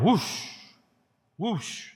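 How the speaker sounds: whooshing like wind blowing through; airy "whoosh" of wind. Retold or imitated. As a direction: imitate a gust of wind. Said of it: "you hear it sound".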